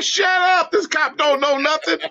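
high, urgent mock hush to a crying sister; quick, high scolding shush. Kid hushing crying sister.

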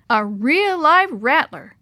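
slow, high, loud marvelling; thrilled awe. A narrator's reading. Boy marvels after the rattlesnake escape, thrilled. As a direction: draw it out with thrilled wonder.

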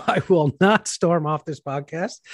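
slow promise through a laugh; amused, drawn-out pledge. Real, not acted. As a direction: swear it while chuckling. Laughing while making the promise.

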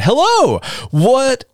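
high, bright burst of a hello; excited greeting. Acted. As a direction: shout the greeting out high and eager. Reading a fan comment aloud.

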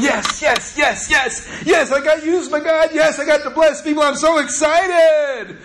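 high excited chant of yes; fired up. Retold or imitated. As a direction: cheer yes over and over, thrilled. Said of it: "he was going".

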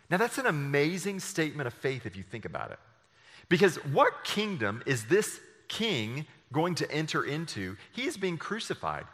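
ordinary talk

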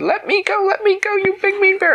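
put-on very high, loud protesting girl; a girl squirming to break free; shrill high let-me-go; struggling Goldilocks. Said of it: "Goldilocks said".

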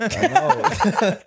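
high squeal of laughter; laughing. Real, not acted. Laughing at the friend who gets him.

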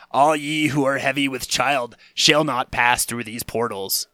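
slow, solemn Ten Commandments decree; grand mock-biblical sign voice; biblical proclamation. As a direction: proclaim it like an Old Testament prophet. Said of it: "something more biblical".

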